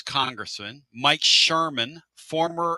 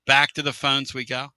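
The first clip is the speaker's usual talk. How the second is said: ordinary talk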